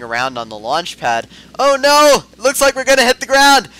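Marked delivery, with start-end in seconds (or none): 1.6-3.7 mock panic. Pilot pretending the craft will crash, in hammed-up panic. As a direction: play at panicking, high, loud and fast.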